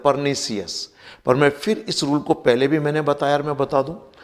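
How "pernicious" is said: pernicious ending in 'si-us', 'per-ni-si-us'; spelling pronunciation. Shown as a demonstration. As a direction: sound out the i in pernicious as 'see-us'. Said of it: "spelling के आधार पर सीएस कह देते हैं". (pernicious को 'परनीसियस' बोलना)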